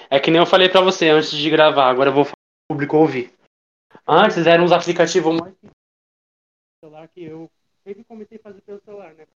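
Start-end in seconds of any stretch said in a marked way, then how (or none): 5.4-9.3 faint, distant phone voice; sounding far off in the background; far from the mic. Real, not acted. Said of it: "sua voz tá lá no fundo".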